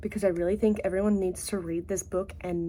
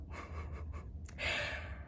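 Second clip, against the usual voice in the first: quiet, breathy little laugh; soft laugh; 14 dB quieter than usual. Real, not acted. Vlogger amused by own "is it not", soft laugh. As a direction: laugh softly to yourself. Laughs after odd formal phrasing.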